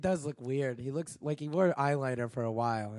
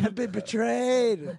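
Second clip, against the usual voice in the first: slow, high, hammed-up despair; mock betrayed; 7 semitones higher, much slower than usual. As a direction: wail it like a wronged fan.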